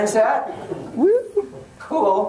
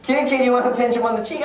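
usual speaking voice

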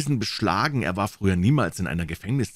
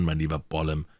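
usual speaking voice

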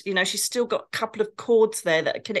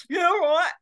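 much higher mock aside out of the side of the mouth; pitched-up sceptical side-mouth yeah right; side-mouth scoff; 10 semitones higher, 4 dB louder than usual. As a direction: mutter it sideways like a disbelieving aside. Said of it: "Side mouth".

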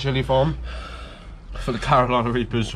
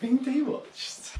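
magic words; 11 semitones higher, 7 dB quieter, much slower than usual. Pretend. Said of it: "Remember to say the magic words".

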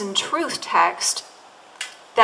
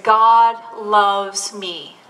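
slow reread; much slower than usual. Retold or imitated. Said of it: "He said ... Read it again".

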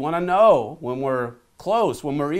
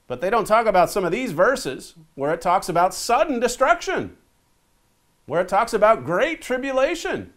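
higher, urgent denunciation; impassioned sermon voice; fervent preaching; 7 semitones higher, 4 dB louder than usual. Acted. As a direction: press hard against the smooth churches. Preacher denounces churches.

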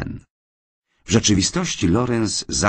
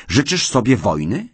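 higher, louder threat; heated challenge; 8 semitones higher, 5 dB louder than usual. Acted. Husband challenges defiant wife, heated. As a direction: throw the question down like a gauntlet.

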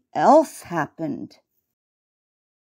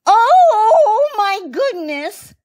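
very high, drawn-out mouse cry; squealing, alarmed little mouse; frightened squeak; 13 semitones higher, 5 dB louder, much slower than usual. A narrator's reading. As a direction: shriek as the alligator bursts in. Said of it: "squeaked Miss Mouse".

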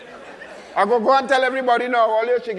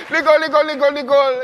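loud, higher calling after her; 5 semitones higher, 5 dB louder, faster than usual. Acted. Husband calling his leaving wife back, loud and pleading. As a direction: call her back before she leaves.

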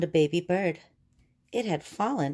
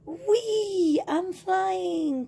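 joyful cry; much slower than usual. Acted. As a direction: squeal with delight as if flying. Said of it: "cried Mole".

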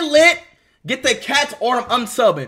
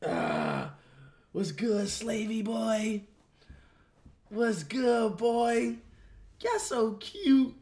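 softer, slow cooing to the cat; soft pet talk; 3 semitones lower, 11 dB quieter, much slower than usual. Real, not acted. Talking softly to cat.

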